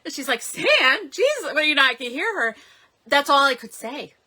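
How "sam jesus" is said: very high, startled exclamation; startled cry. Retold or imitated. Mother answering the phone to yelling, high startled cry. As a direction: yelp the names in high alarm.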